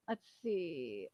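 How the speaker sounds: ordinary delivery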